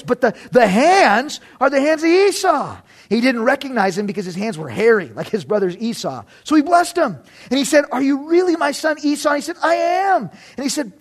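emphatic storytelling read-aloud; scripture reading. A narrator's reading. Preacher reads the chapter to the congregation, emphatic narration. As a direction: read the Bible story out with lifted, lively emphasis.

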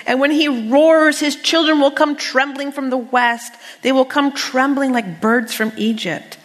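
stirring scripture proclamation; preaching emphasis. Acted. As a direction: proclaim it over the room. Preaching scripture at full force.

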